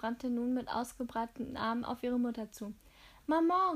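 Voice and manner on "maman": high, loud call to mother; excited daughter's cry across a corridor. A narrator's reading. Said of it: "rief sie laut".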